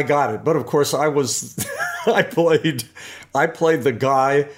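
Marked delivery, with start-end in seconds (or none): 1.9-2.8 laughs through the words; chuckling as he starts to tell it; amused laugh on the words. Real, not acted. Laughing while recalling bit part.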